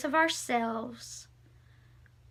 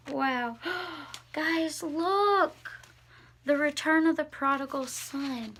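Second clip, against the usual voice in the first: ordinary delivery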